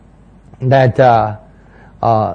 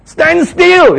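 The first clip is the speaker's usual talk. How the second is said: high, loud command; 12 semitones higher, 4 dB louder than usual. Acted. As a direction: order the crowd to stand still. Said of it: "everybody standing still".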